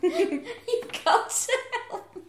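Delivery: high, slow, laughing whine; laughing mock plea. Friend imitates the pretend paralysed girlfriend, laughing whine. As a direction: plead through laughter.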